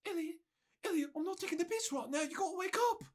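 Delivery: hushed, high Cockney voice; Cockney accent. Retold or imitated. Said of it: "go with some sort of Cockney accent".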